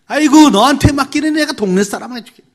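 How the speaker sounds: very high, loud mock outburst; scornful parent. Parent refuses to trust a child, scornful outburst. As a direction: cry it out in exaggerated disbelief.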